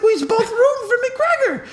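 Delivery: high, indignant reminder; indignant protest. Retold or imitated. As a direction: point out the obvious, offended. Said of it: "I was like".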